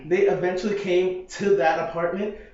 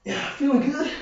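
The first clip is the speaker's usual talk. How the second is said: hype; 11 semitones higher than usual. Real, not acted. Pumping himself up at hammer game.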